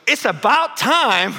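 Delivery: high, loud, drawn-out mock holler; imitated neighbor's yell. Lady scolding neighbor about overgrown bush, mock yelling. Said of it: "she was yelling at me".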